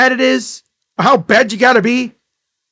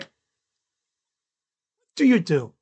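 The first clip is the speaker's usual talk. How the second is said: incredulous; 3 semitones lower, 6 dB quieter, slower than usual. Retold or imitated. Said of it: "You look at him and you're like".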